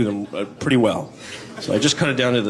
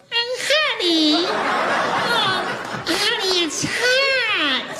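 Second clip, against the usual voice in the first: extremely high, whiny squeal; squeaky whine; much slower than usual. Retold or imitated. Partner after six months whining honey, extremely high squeal. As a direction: squeal like it's unbearable. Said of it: "your voice changes over time".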